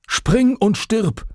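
sehr hoch, langsam und laut gedehnt gebrüllt. Acted. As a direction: dehn jedes Wort als wütenden Schrei. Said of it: "brüllte er mir hinterher". (Vater brüllt dem Sohn langsam und gedehnt hinterher, sehr hoch und laut)

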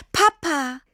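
high, relieved cry of the name; joyful cry. A narrator's reading. Girl finding her father in the woods, joyful cry. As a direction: burst out in relief.

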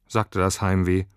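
ordinary delivery, nothing marked

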